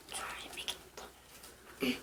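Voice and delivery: soft slow whisper. Real, not acted. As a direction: whisper it slowly, barely voiced. Whispers during trick.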